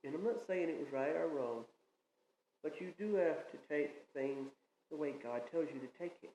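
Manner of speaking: usual speaking voice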